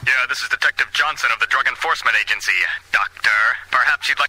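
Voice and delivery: low-pitched official cop voice; deep, formal lawman on the phone; drug-enforcement detective. Acted. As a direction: play a detective, voice dropped low. Drug agent phones the drug hotline.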